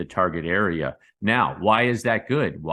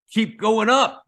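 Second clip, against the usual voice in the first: much higher and louder burst; excited; 12 semitones higher, 6 dB louder, slower than usual. Real, not acted. YouTuber cheers Bitcoin's run to viewers, loud and excited. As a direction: cheer the rally on.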